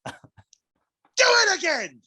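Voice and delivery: very high, slow crying shout; mock chef shout. Mock chef screaming at the cook. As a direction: wail it like an enraged chef.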